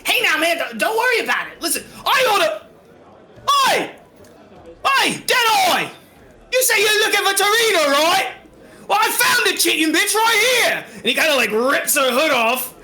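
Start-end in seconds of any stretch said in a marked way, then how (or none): 0.0-1.6 little voice. Acted. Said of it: "like, a little voice go". 1.6-10.8 high, pushy shout across the room; calling out. Acted. Captor calls out to Deadeye from loft.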